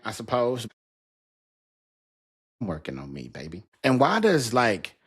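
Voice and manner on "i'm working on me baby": soft low sign-off. Real, not acted.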